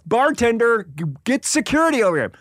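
high-pitched, hurried call for help; urgent calling out. Retold or imitated. Comedian fighting off a woman calls the bartender, urgently.

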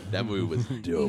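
a touch of Sam Elliott again, laughing in. Retold or imitated. Asked to say it again, lightly voiced Sam Elliott. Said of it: "talk in that voice all the time".

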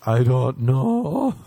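loud, drawn-out mock panic; freaking out. To his buddy asking about the blood, freaking out. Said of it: "Freaking out".

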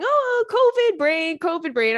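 high, slow, loud pet call; cooing at cat. Retold or imitated. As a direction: coo at the cat, stretching it out. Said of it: "I'm going".

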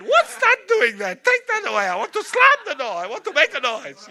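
high, loud, hammed-up rant; mock angry Italian mother. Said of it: "She would go off like that".

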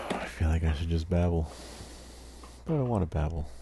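talking while stoned. Real, not acted. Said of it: "So stoned right now".

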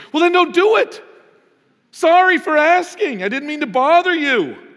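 hammed-up, high and loud motherly outcry; offended mom. Mom replying to her son's warning, hammed-up offended cry. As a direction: play a wounded mom, wailing loudly in mock offence. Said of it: "Every mom would say".

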